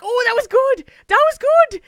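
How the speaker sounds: loud, high mock-enthusiastic cheer; excited praise. Teacher's excited praise retold.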